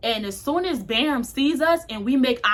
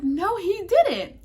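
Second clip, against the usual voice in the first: shocked disbelief; 9 semitones higher, much slower than usual. Retold or imitated. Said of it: "I was like".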